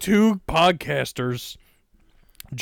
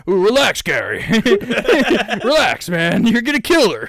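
louder, laughing urging to relax; mock excited; 7 semitones higher, 5 dB louder than usual. Telling the dad to relax in the joke story, laughing and loud. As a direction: egg him on through laughter. Acted quote during the story.

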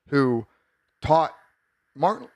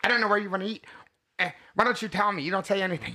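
higher-pitched imitation of a complaining girlfriend; put-on pestering, pitched up; mock nagging girlfriend; 5 semitones higher, much faster than usual. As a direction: imitate a girl bitching over the phone. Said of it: "a girl bitching on you on the phone".

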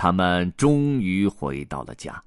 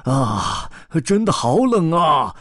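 cry out; 8 semitones higher, 5 dB louder than usual. Acted. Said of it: "一头大象大叫".

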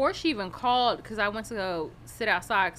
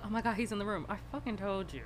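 much softer, hushed panic; 4 semitones lower, 13 dB quieter than usual. Retold or imitated. Telling the other girl he is in the room, hushed. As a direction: drop to a hushed urgent voice.

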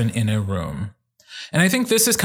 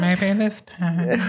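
slow, high mock croon with a laugh; hammed-up barfay warble; 5 semitones higher, much slower than usual. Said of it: "when you go nasally".

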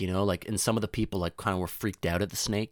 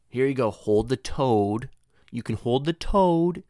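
slow, higher coaxing teacher voice; teacher handing out pets; 4 semitones higher, 5 dB louder, slower than usual. Retold or imitated. Teacher handing a toad to a grade three kid, slow and coaxing. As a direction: stretch it out kindly for little kids. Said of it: "She'd be like".

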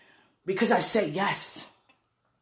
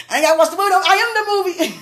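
louder, higher, teasing; playful excited brag; 8 semitones higher, 5 dB louder, much faster than usual. Pretend. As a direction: show off with a grin. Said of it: "No, I'm just playing".

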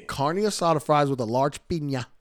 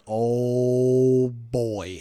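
drawn out savoring; 6 dB louder than usual. Real, not acted. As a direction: stretch it out with relish. Savoring the food.

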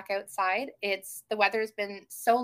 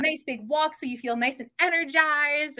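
higher, louder eager gush with a gasp first; retold bubbling enthusiasm, pitched up; all excited; 5 semitones higher, 5 dB louder than usual. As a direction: imitate an excited partner rattling off happy plans. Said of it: "He got all excited. He was like".

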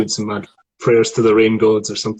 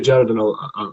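ordinary talk

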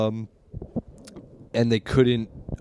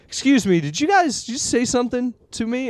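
higher, puzzled questioning voice; confused customer; 12 semitones higher than usual. Retold or imitated. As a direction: ask it baffled and high.

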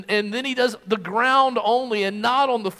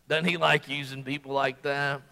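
low, soft, chuckling aside; amused retelling with a brief chuckle; laughing aside; 8 semitones lower, 6 dB quieter than usual. Retold or imitated. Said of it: "would laugh at this point and say".

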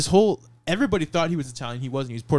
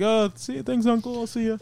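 awkward goodbye; 8 semitones higher than usual. Retold or imitated. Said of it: "I had to awkwardly hang up".

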